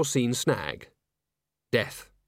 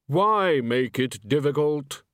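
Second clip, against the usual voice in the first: Death voice; 4 semitones higher, slower than usual. Acted.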